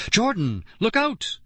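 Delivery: very high alarmed cry; frantic high warning shout; cried out warning. A narrator's reading. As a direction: yell a warning to your brother. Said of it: "Lauren cried".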